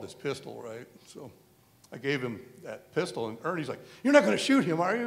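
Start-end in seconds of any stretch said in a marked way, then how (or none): 4.0-5.1 higher, louder retold disbelief; incredulous protest. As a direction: imitate an alarmed, pleading question. Incredulous protest, voicing Ernie.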